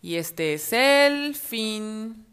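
very slow closing line; story ending. A narrator's reading.

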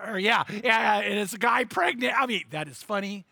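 ordinary talk

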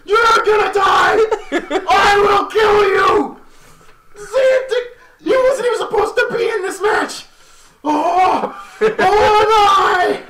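high, loud laughing death threats; crazed wrestler. Retold or imitated. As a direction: scream threats like a maniac. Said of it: "Just like".

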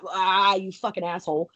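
usual speaking voice

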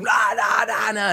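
loud rising rah rah rah, fired up; mock hype shout. Retelling early days, he shouts hyped rah rah rah. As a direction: pump up the crowd with rah rah. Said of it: "I was fucking really fired up".

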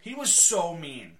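hammed-up indignation; emphatic complaint. Real, not acted. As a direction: stretch it out in disbelief.

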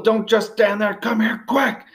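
high, urgent order; acted urgent shout, pitched well up; mom yelling. A narrator's reading. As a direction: yell at your son to hurry. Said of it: "She yells at Will".

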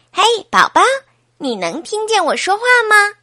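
put-on high child's voice, asking; Laura. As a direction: call brightly to the baby in a child's pitch. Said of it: "劳拉把头贴在妈妈的肚子上".

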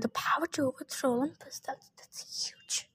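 hushed, trailing into a whisper; awed words sinking to a whisper; muttering. A narrator's reading. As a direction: mutter it softly, slowing at the end. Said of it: "Thalia muttered".

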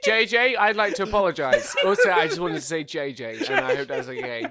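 trying to be sincere but giggling; giggling apology. Real, not acted. Co-host apologising to listener J J, giggling.

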